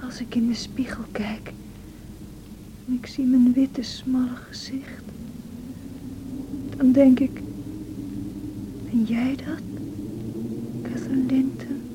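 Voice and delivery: fragile whispered lament; a sick woman's whispered despair; faint, sad whisper. Acted. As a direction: barely voice it, worn out and forlorn. Weak Cathy confides in Nelly.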